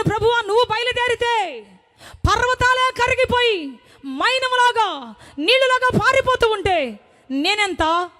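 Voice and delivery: high, slow fervent pleading; fervent faith. Retold or imitated. Sick woman praying to God for healing, fervent high plea. Fervent prayer about kidney stone.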